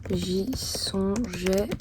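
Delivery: slow, halting words with long pauses; dictating while writing. Real, not acted. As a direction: say each phrase as you write it down. Composes letter aloud while writing it.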